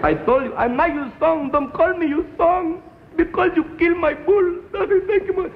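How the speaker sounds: put-on high, strained shouting father in a broken-English accent; an exasperated dad yelling at his son; angry father, accented. Father snapping at son over the bull, high and strained.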